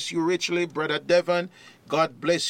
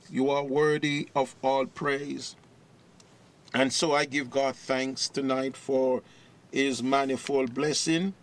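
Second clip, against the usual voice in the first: normal talking voice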